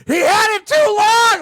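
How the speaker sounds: high, drawn-out furious yell; screaming at television. Retold or imitated. Fan yelling at the TV over a buzzer beater, loud outraged scream. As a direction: re-enact an outraged fan's scream. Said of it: "I screamed at the television".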